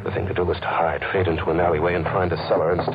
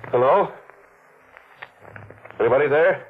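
calling out; 5 semitones higher than usual. Acted. Hunted man calls into the cellar, nervous call. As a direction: call out, uneasy, to whoever is there.

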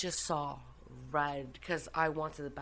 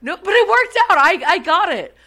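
much higher, much louder laughing protest; excited protest; 12 semitones higher, 17 dB louder than usual. Real, not acted. Telling her friend it worked out, excited and loud. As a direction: burst out defending yourself. Defending herself amid laughter.

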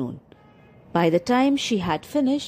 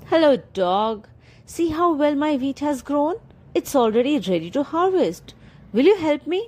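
lightly voiced hen, higher, eager; high hopeful hen; 7 semitones higher than usual. Hen asks the dog to help harvest, high and hopeful. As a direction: coax the dog with a high plea.

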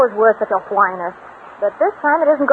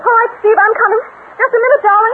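calling out; 9 semitones higher, 3 dB louder than usual. Acted. Calling through door to Steve.

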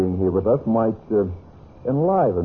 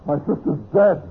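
shocked horror; 7 semitones higher than usual. Acted. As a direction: reel from the discovery. Discovers sister murdered.

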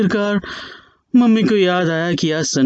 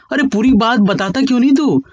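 pushy father; 6 semitones higher than usual. Acted. Father demanding his son explain where he goes, insistent. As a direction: nag him to speak up.

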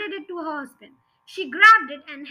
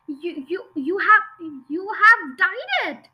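stammered, building into a high, loud cry; appalled shriek, last words drawn out; 4 semitones higher, 6 dB louder than usual. A narrator's reading. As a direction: stutter in shock, then burst out. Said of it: "shrieked the mother".

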